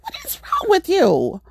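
high-pitched, appalled demand; squealed exasperation; incredulous scolding. Retold or imitated. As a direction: retell it in hammed-up disbelief. Retold disbelief at neighbor.

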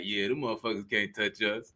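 very low, quiet swagger; cocky cousin. Retold or imitated. Friend mimics cousin boasting after police left, low cocky voice. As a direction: imitate a low, cool boast.

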